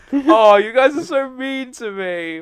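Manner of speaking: slow, high mock-wounded whine; mock hurt whine.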